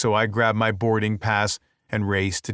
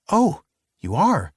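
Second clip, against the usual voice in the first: bright higher woman's voice; female attendant voice; 7 semitones higher, 3 dB louder than usual. A narrator's reading. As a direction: lightly voice the pleased attendant. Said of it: "she says".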